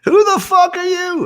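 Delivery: very high falsetto impression, cackling; high, slow, hugely amused mimicry. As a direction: squeal it like a delighted old rocker. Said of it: "[name] just thinks this is the funniest thing".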